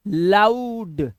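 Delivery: लाउड drawn out very slowly, the आउ glide held long; stretched loud. Shown as a demonstration. Teacher sounding out लाउड for students, very slow. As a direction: stretch the आउ in लाउड before landing on the ड. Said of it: "आउ".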